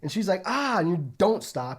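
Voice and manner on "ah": mock pained cry. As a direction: give a small imitated scream. Elephant's ear is pierced.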